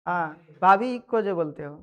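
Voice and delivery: ordinary talk, nothing marked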